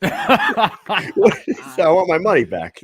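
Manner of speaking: hammed-up indignant sitcom character; mock outraged customer. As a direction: squeal for your money back in mock outrage. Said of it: "He goes".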